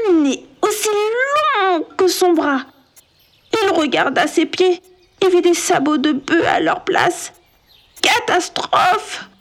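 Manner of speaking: rising horror. A narrator's reading. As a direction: build the shock with each detail. Horrified narration of reflection.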